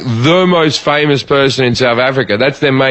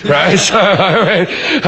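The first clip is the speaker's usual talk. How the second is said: usual speaking voice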